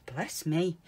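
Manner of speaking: ordinary talk, nothing marked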